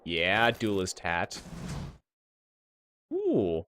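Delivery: ordinary delivery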